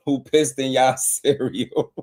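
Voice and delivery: laughing through the question; can barely get it out for laughing. Real, not acted. Streamer to chat, cracking up mid-question. Laughing at angry boxing fans.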